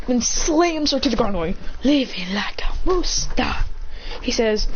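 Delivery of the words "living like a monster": slow whispered taunt; harsh whisper. Acted. Acting out an attacker slamming her down, whispering. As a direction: whisper it slowly, drawn out.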